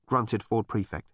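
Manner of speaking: plain reading voice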